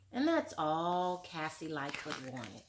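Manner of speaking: usual speaking voice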